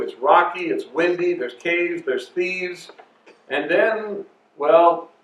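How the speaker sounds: usual speaking voice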